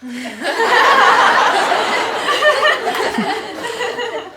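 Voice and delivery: long high laugh; real laughter; cracking up after the net joke. Real, not acted.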